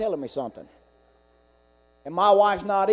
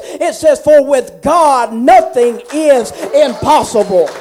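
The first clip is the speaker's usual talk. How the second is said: loud, high, fervent preacher's cry; preaching at full force; 7 semitones higher, 10 dB louder than usual. Real, not acted. Preacher testifying to the congregation, loud and fervent. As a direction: build the testimony into a ringing shout.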